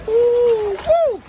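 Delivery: long, high, loud "ooh" into a whoop; excited whoop. Real, not acted. Angler reacting to a good one on the line, excited whoop. Cheering a hooked fish.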